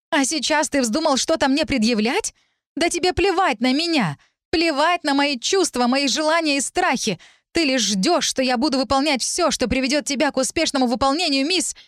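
high-pitched indignant tirade; rising indignation at no extra volume; angry outburst. A narrator's reading. Heroine berating Lir until he cuts in, indignant.

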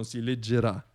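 ordinary talk, nothing marked

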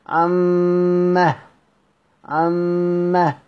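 عما drawn out slowly with a breathy final ha; pausal ha of silence. Shown as a demonstration. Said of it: "نقف عليها بالبز". (عما ممدودة بهاء سكت في الوقف)

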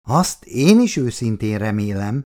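plain reading voice

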